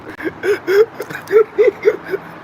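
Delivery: squealing giggle, high and helpless; can't stop laughing, squeaking high; high-pitched giggle fit. Real, not acted. Streamer cracking up at his own joke, squealing giggle. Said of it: "I'm laughing like a fucking idiot".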